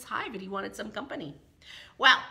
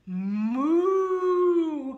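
long, loud, higher moo; cow mooing; 7 semitones higher, 7 dB louder than usual. Acted. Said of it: "The cow said".

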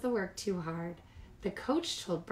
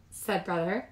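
plain reading voice

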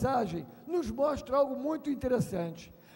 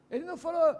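ordinary talk

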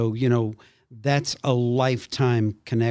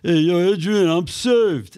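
slow, louder Philly tough-guy drawl; Rocky impression; 3 semitones higher, 4 dB louder than usual. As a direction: tough boxer voice, dragging each word. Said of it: "impersonations of Rocky going to church".

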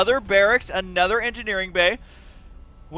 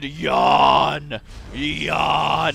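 low rasping growl on "yawn"; raspy mock smoker growl; death metal smoker voice; 4 semitones lower than usual. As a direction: drag it out like a growling metal singer. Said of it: "the death metal smoker voice".

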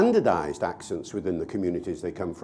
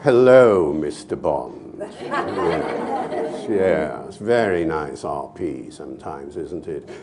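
slow, low RP villain drawl; posh Bond villain; 5 semitones lower, slower than usual. Pretend. Villain greeting Mister Bond, slow and low posh menace. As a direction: menace in leisurely, lowered posh tones.

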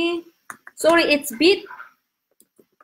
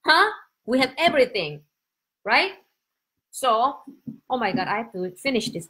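ordinary talk

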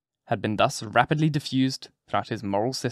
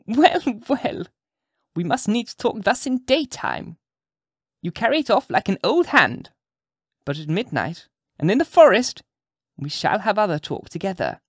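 much higher, sly crone voice; cackling wicked witch; 8 semitones higher than usual. A narrator's reading. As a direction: tease him like a knowing hag. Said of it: "cackled the old witch lady".

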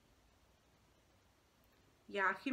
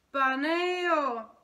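loud, higher, drawn-out wow; thrilled; 6 semitones higher, 12 dB louder than usual. Acted. Boy hearing his dream plans, thrilled. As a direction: exclaim with delighted awe. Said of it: "vydechl nadšeně".

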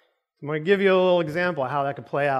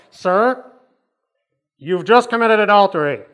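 loud, raised announcing voice; public accusation; 3 semitones higher, 7 dB louder than usual. Acted. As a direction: call out the sinner for all to hear. Street preacher publicly accuses Tommy.

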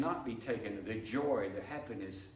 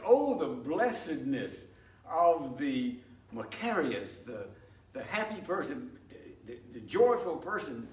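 rising preaching fervor; 3 semitones higher, 5 dB louder than usual. Acted. Preacher swelling over the congregation, fervent. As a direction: lift the congregation with joy.

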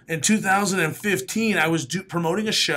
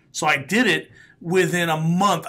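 ordinary talk

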